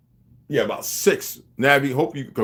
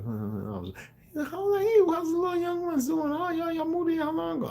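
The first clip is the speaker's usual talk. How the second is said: much higher, sugary fake-nice voice, slow start; mock sweet neighbor; 5 dB quieter than usual. To the new neighbors at the door, a high mock-sweet greeting. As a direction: play the overly sweet nosy neighbor, pitched way up. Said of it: "I'll be knocking on the door".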